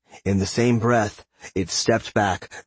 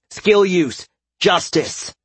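battle cry; 9 semitones higher, 4 dB louder, much slower than usual. A narrator's reading. Battle skill called out.

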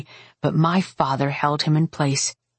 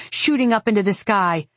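ordinary delivery, nothing marked

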